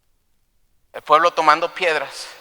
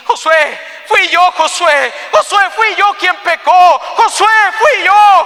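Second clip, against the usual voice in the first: high, drawn-out shout, building to a peak; desperate shamed confession; 14 semitones higher, 7 dB louder, slower than usual. Acted. Achan owning up to Joshua before everyone, desperate shout. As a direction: cry out the guilt louder each time. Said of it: "Acán avergonzado allí".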